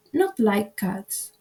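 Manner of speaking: plain reading voice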